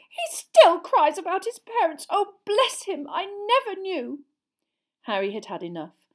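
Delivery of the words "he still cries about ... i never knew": high, loud mock-motherly lament; anxious mother impression. Son imitating his mum pitying the orphaned boy, high and anxious. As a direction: fuss and pity in a very high voice. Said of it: "imitated his mother's anxious voice".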